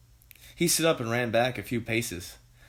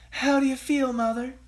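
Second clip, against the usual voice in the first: anxious daughter; 12 semitones higher, 3 dB louder than usual. A narrator's reading. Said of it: "asked Missus Cranky, anxiously".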